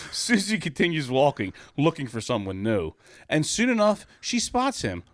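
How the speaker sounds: a sing-song, rhyming storybook read; mock-innocent children's storybook reading. A narrator's reading. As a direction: read it like a picture book at story time. Storybook narration.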